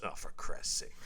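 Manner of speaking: whispered, weary disgust under the breath; exasperated whisper. Retold or imitated. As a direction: mutter it like you've given up. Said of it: "then he just goes".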